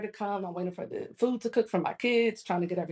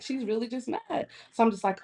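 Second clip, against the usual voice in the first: ordinary talk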